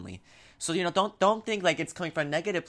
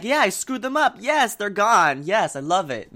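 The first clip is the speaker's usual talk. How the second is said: higher, louder victory crow; gleeful gloating; 6 semitones higher, 7 dB louder than usual. Acted. Player relishing a rival knocked out of the race, gleeful gloating.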